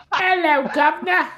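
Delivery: very high, loud cockney squeak; chirpy cockney greeting in a squeaky falsetto; British droid voice. Acted. Droid changed to British for the game, in a high cockney accent. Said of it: "Should he be British?".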